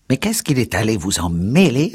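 vieille qui s'écrie, plus aiguë; cri de dépit. A narrator's reading. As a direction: lancer le reproche avec dépit. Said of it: "s'écria la vieille avec dépit". (la vieille s'écrie avec dépit, aiguë)

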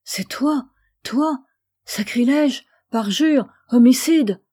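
a brother's growing furious cry; accusing outcry. A narrator's reading. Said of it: "s'écrie son frère".

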